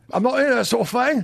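high-pitched comic Cockney catchphrase; Cockney accent. Acted. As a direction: put on an exaggerated Cockney voice. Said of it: "a Cockney sounds".

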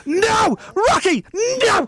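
high, hammed-up wail of despair; mock anguished cry. Host crying out at Rocky in hammed-up despair. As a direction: cry out in fake heartbreak. Mock outrage at meek answer.

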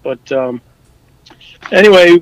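ordinary delivery

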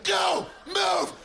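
very high barked orders shouted like a drill sergeant. Retold or imitated. Dad shouting his kids awake on Sunday like a sergeant. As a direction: bark the morning orders like a sergeant in a war. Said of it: "he just goes".

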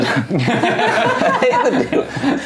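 laughing repeat. Real, not acted. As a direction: drag it out while cracking up. Repeat, much higher, laughing.

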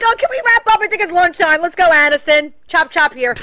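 high, loud mock bossiness; impatient hurrying. Actress hurrying Aniston to wrap for lunch, impatient.

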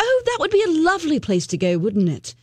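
imitating a put-on posh British accent; lightly mocking British voice; fake British accent. Woman's put-on British accent quoted.

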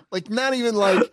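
normal talking voice

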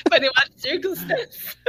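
giggling through the question; laughing. Real, not acted. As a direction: laugh while asking.

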